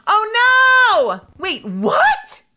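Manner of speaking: high, loud cry of alarm turning to confusion; startled yelp then a baffled question; shocked shriek. Real, not acted. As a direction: caught off guard mid-game. Character suddenly dies in game.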